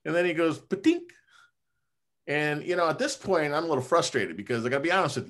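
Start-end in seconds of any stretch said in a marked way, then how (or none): 0.6-1.1 high, sudden mock "Patink"; imitating the chiropractor's tool; mimicked adjuster click. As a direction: pop the sound out high and sharp. Doctor's adjustment sound imitated.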